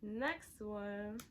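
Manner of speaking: ordinary delivery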